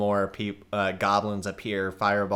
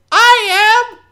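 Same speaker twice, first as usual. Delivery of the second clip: shrill, much higher shout from the back; a young goblin yelling far higher and louder; young goblin calling out; 18 dB louder than usual. Acted. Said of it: "from the back".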